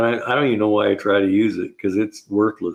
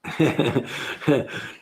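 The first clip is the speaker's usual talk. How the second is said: long, softer, higher chuckle; real laugh, pitched up; amused chuckle; 4 semitones higher, 5 dB quieter than usual. Real, not acted. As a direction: chuckle away at your own story. Laughs after own funny story.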